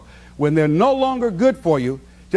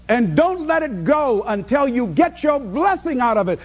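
normal talking voice

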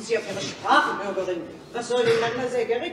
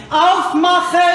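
drawn-out shout, louder and higher; calling out; 6 semitones higher, 8 dB louder, slower than usual. Acted. Hungry women at the bakery door, drawn-out shout. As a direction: bellow at the closed shop door.

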